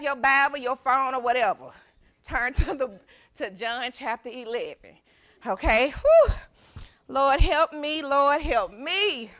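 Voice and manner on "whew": high, loud "whew"; overwhelmed whew. Real, not acted. Preacher asking the Lord to help her, high loud whew. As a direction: let out a high whoop of overwhelm.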